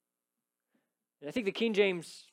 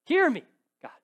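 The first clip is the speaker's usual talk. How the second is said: intense plea; 6 dB louder than usual. Acted. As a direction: beg God to hear, voice climbing. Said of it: "this growing intensity about his pleading".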